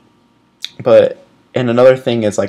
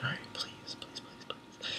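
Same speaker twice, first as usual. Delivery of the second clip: breathless whispered please please; 27 dB quieter than usual. Retold or imitated. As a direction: whisper your hope under your breath. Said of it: "and I'm like".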